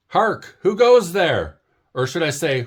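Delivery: high, grand, hammed-up old knightly talk; a little bird playing a noble knight; mock knight speech. Early Bird waking Owl, hammed-up knightly call.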